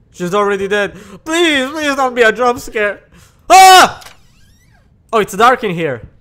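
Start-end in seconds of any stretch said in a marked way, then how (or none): 3.5-4.0 very loud, high shriek; terrified scream. Real, not acted. Gamer caught by the scare he dreaded, loud scream.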